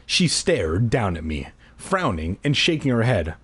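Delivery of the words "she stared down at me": ordinary delivery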